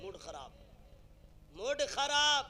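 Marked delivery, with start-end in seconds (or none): 1.5-2.5 loud, high mock outburst; raised-voice show of a spoiled mood; angry outburst. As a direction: burst out loudly in mock annoyance.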